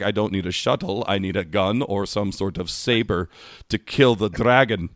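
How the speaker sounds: put-on Scottish accent, slowing at the end; mock Scottish indignation. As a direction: imitate a Scottish guest demanding a weapon. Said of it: "he's from Scotland originally".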